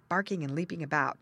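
plain reading voice

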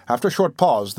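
ordinary delivery

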